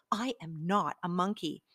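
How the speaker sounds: plain reading voice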